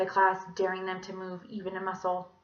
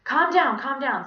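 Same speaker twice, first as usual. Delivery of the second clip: raised-voice teacher settling a class; calling for calm; 5 semitones higher, 8 dB louder than usual. A narrator's reading. Miss Mush to panicking kids, calling for calm. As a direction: call out over the noise.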